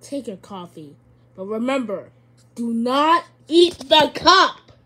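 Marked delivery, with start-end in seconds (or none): 1.3-4.7 loud, slow warning, every word spelled out; loud stern warning. Pretend. Gamer warns the pumpkin man not to eat the cup, loud and slow. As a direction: lay down the law, slowly and loudly.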